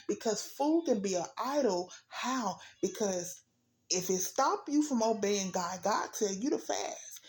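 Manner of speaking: preaching at full force. Acted. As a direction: preach it hard to the congregation.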